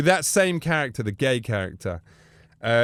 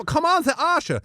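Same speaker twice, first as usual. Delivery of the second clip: much higher coaxing Austrian voice; Bruno voice; 12 semitones higher than usual. Retold or imitated. Bruno coaxing the wrestlers, in a high coaxing voice. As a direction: wheedle like a flirty Austrian. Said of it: "I go".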